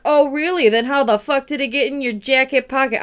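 Chicago cop. Acted. Knight catching the king, in a brash Chicago accent. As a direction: bust him like a Chicago beat cop. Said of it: "They sound like Chicago cops".

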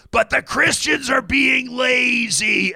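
high, slow, drawn-out rally shout; stretched-out mock harangue; rally speaker. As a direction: mimic a speaker scolding the crowd from the stage. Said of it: "And then Parker goes".

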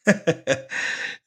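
loud, high laugh; delighted laugh. Real, not acted. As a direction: burst out laughing after the clip. Host reacts to played clip.

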